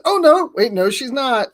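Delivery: high-pitched sudden double take; mock startled reversal. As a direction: imitate a viewer caught out by a fake-out. Mimicking death fake-out reaction.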